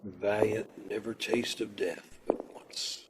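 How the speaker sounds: hushed whisper of the line; whispered verse. Acted. Prisoner whispering Shakespeare while walking to execution. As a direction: murmur it to steady yourself. Murmured on the way to death.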